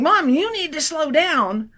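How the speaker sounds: lightly voiced whining fifth grader, drawn out; a hint of a child nagging from the back seat; whiny kid voice. Daughter nagging mom from the back seat, mild whine. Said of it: "fifth grader is saying".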